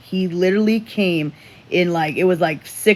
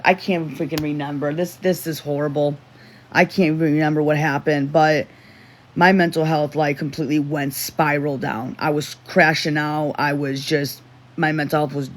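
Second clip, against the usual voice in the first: ordinary talk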